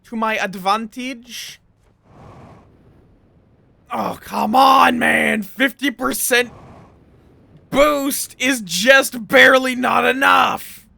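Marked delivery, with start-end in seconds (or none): 3.9-10.9 slow, loud, higher exasperated yell; frustrated shout. Real, not acted. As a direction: cry out in real frustration. Frustrated at failing the jump.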